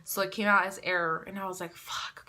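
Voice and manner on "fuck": hushed, voiceless curse; whispered curse. Retold or imitated. Reacting to a test error, whispered letdown. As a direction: swear under your breath.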